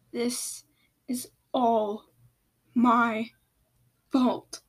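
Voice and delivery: one word at a time, long pauses; halting repetition. A narrator's reading. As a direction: spell out the guilt word by word. Same line repeated, word by word.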